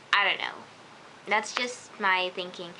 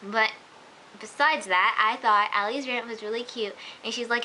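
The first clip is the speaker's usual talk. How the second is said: ordinary talk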